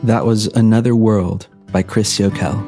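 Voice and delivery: ordinary talk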